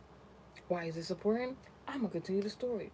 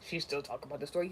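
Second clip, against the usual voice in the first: mocking viewers; 5 semitones lower, faster than usual.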